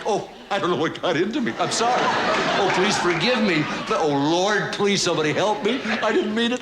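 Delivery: high, pleading, guilt-stricken apology; grovelling dog. Acted. Guilty dog begging his owner to forgive him, grovelling plea. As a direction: beg frantically for mercy.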